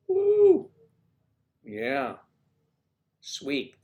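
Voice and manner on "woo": a high cheering whoop; fan's high woo. Real, not acted. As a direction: whoop for the team.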